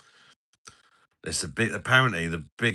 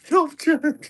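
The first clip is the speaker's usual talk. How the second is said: much higher mock voice of a mate; Patter's voice; 7 dB louder than usual. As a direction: squeak it out like a teasing friend. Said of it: "Knob train in Patter's voice".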